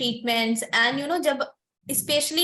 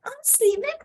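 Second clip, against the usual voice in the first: mock exasperation; 9 semitones higher, 4 dB louder than usual. Exasperated about going in slippers.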